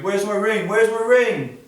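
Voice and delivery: high, panicked "Where's my ring?"; rising panic, she can't find it; frantic search. Acted. Bella searching her room in panic, high and frantic.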